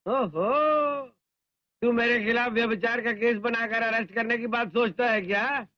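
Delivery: usual speaking voice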